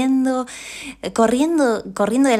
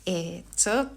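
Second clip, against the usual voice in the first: ordinary talk, nothing marked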